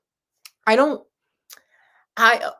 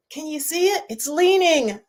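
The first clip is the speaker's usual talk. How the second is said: pretend tourist excitement; 9 semitones higher, 3 dB louder than usual. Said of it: "I'd be like".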